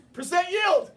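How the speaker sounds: silly meme voice. Pretend. Silly meme non sequitur.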